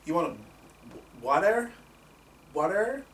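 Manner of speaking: lightly voiced puzzled American, rising water?; mock American. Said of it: "instantly were like".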